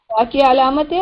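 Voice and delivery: ordinary talk, nothing marked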